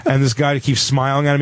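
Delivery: usual speaking voice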